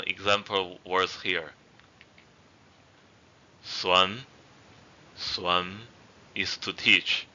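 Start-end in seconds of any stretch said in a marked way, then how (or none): 3.6-4.2 "Suan" with a gentle rise in pitch; rising tone. Shown as a demonstration. Teacher showing learners the first tone, rising tone on "Suan". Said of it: "raising tone". 5.2-5.8 "suan" rising gently at the end. Shown as a demonstration. Teacher repeating the example word, gentle rising tone on "suan". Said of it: "raising tone".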